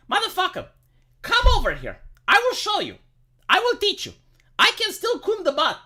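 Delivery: high-pitched half-joking rant; mock fury. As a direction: challenge the critic, pitch up and heated. Ranting at mocking critics.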